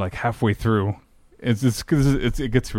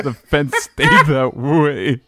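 slow, laughing through the words then bursting out; 5 semitones higher, 3 dB louder, much slower than usual. Real, not acted. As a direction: dissolve into real laughter at the punchline. Laughing at unfinished fence.

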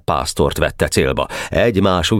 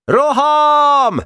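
very high, ringing charge cry; battle shout; 4 dB louder than usual. A narrator's reading. Boka calling the hidden troops to charge, battle shout. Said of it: "bekiáltott".

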